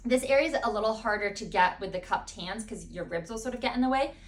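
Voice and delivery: ordinary talk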